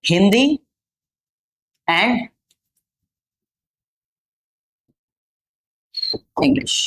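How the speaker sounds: slow, word-by-word English answer; slow model answer. Shown as a demonstration. Teacher modelling an answer slowly for students. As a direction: say it slowly, one word at a time. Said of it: "या".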